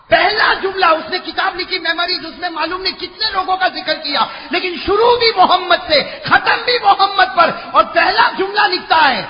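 high, loud sermon cry; fiery preaching. Acted. Preacher stirring the crowd, fiery preaching. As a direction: preach it out with fervour.

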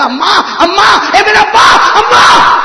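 high, loud, slow screams for mother; child screaming. Acted. Dying little boy screaming for his mother and father. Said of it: "وہ چلانے لگا".

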